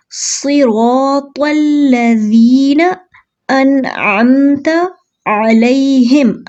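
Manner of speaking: very slow melodic tajweed recitation; Quran recitation. Shown as a demonstration. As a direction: draw it out as tilawat. Recites verse. (धीमी तिलावत)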